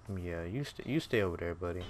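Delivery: normal talking voice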